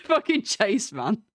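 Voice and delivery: usual speaking voice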